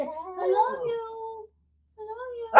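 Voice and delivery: soft, very slow, high coo; hushed, drawn-out high pet voice. Shown as a demonstration. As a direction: coo it quietly for the dog to copy. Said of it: "say it lower".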